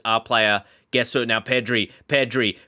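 ordinary talk, nothing marked

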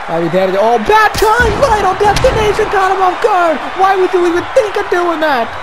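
normal talking voice

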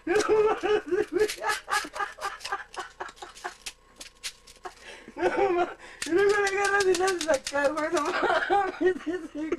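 can't stop laughing while talking; hysterical laugh. Real, not acted. Retelling while laughing hard.

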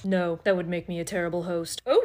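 ordinary delivery

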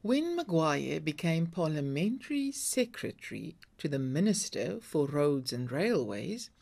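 plain reading voice

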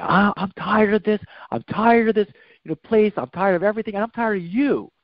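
high, mock angry yell; a hint of an angry recruit screaming; retold yelling. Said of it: "he starts yelling and screaming at me".

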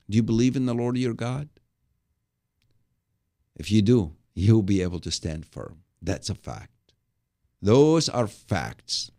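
ordinary talk

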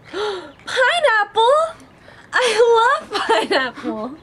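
high, slow, loud happy squeal; delighted squeal. Real, not acted. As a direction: cheer like a gift came true. Opens can, finds favourite fruit.

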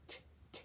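unvoiced t sounds, just the tongue tapping; whispered t. Shown as a demonstration. Music teacher showing tonguing with breathy t sounds. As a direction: tap out t, t with breath only. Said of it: "putting the T sound".

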